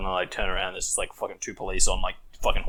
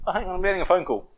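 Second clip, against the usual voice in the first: high hurried cop; 11 semitones higher than usual. Retold or imitated. Cop takes call, high fast.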